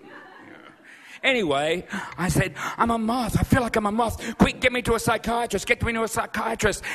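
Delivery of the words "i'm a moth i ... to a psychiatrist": mock panic. Freaking out to taxi driver.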